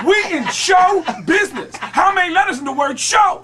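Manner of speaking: mock-outraged high shout; loud, high comic interrogation; Tracy Morgan. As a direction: hammer it out in a hammed-up impersonation. Tracy yelling at Kurt, acted.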